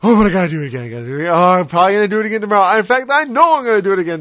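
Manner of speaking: loud, fast, breezy confession; mock careless sinner. Preacher parodies a careless sinner, loud breezy mock. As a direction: toss off the sin like it's nothing.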